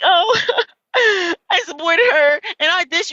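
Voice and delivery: mock her teary live voice; hammed-up sob story; mock crying. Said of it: "then you get on the live like".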